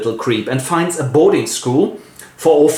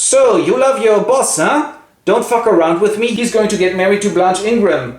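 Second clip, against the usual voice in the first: disguised fortune-teller; 7 semitones higher than usual. Acted. Disguised Rochester teasing Jane, high and mocking. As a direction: tease her like a sly fortune-teller.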